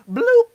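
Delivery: high, loud "bloop"; hang-up bloop. Retold or imitated. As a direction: imitate a phone cutting off, sharply. Imitates the sound of hanging up.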